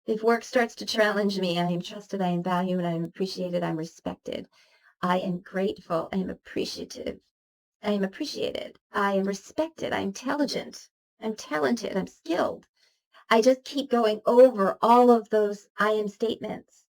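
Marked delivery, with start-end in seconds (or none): 1.6-12.7 softer, murmured string of I am lines; calm yourself with a whispered mantra; quiet affirmation. Shown as a demonstration. Steadying herself when work gets hard, soft murmured mantra.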